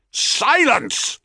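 high, sharp commanding yell. Acted. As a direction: bark the order across a field. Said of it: "yelled Cadmus".

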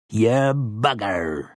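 put-on slow gruff old man exclaiming. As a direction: drag out an old man's oath. Grandpere's final word.

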